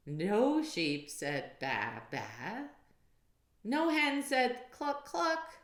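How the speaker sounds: ordinary delivery, nothing marked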